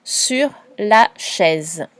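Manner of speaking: very slow, each word spaced apart; slowed dictation for a child. Shown as a demonstration. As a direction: dictate slowly so the pupil can write. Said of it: "Je te laisse écrire ces trois mots".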